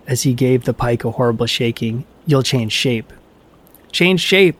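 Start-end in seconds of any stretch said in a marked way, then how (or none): 3.9-4.6 higher, ringing echo of the words; cavern echo. A narrator's reading. The cavern echoes Loki back at him, higher echoing call. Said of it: "echoed the cavern".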